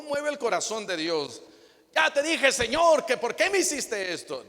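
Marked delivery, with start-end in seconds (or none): 1.9-4.4 much higher and louder, reproachful; complaining bitterly to God; bitter complaint. Acted. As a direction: demand answers from God.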